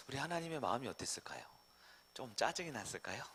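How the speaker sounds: soft, intimate whisper. Real, not acted. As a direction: lean in and whisper the question. Preacher whispers a question.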